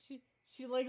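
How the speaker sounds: normal talking voice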